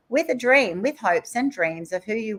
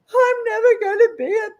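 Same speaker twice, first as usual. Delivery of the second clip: high, tearful wail, louder at first; reliving a tearful despair, voice cracking; retold crying; 8 semitones higher, 6 dB louder than usual. Crying to her husband that she'll never be a CEO. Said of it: "those crying moments with hubby going".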